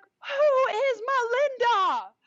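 very high, slow, shrieking demand; furious mom. Retold or imitated. Mom bursting in at three in the morning, a slow furious shriek. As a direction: shriek each word like an outraged mother.

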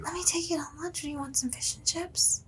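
whispered husband imitation. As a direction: whisper it like a doting husband offering lunch. Wife imitating husband's sweet offer.

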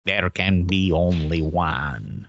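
deep, drawn-out epic pronouncement; Highlander movie quote. Retold or imitated. Quoting Lambert's Highlander line to friends, low and slow.

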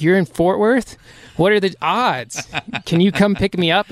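mock amazed, high and loud; surprised relief. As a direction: retell it like sudden good luck. Surprised buddy is nearby too.

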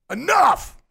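high, loud "Enough!"; sharp burst of command; bold shout. Shown as a demonstration. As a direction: shout it boldly to stop someone coming. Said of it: "say it in a bold voice".

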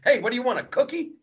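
high, needling insult-comic jab; Don Rickles insult. Retold or imitated. Comic voicing Don Rickles jokes, high needling jab. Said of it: "World War Two jokes".

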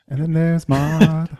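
slow, drawn-out announcement; dramatic voice. Acted. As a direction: intone it slowly and grandly. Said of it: "are you gonna do that the whole game".

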